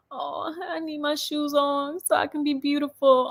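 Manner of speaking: imitated sensitive child. Daughter fussing about being beautiful, lightly imitated child. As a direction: lightly mimic a touchy daughter. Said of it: "she be so sensitive now".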